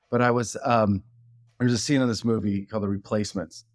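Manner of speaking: ordinary delivery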